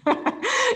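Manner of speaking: high laugh bursting out; real laugh, high and loud; burst of laughter. Real, not acted. Laughs at teeth kicked in.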